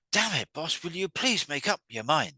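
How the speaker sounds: exasperated outburst, high and loud. Retold or imitated. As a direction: act out a worker loudly fed up with the boss. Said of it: "they've raised their voice".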